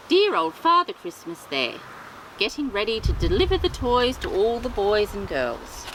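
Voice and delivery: plain reading voice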